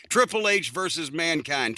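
normal talking voice

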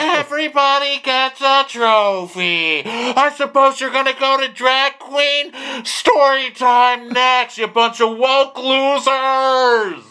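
high, loud mock tantrum; screaming crybaby. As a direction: draw it out into a bawling insult. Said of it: "screaming that everybody else is a wussy".

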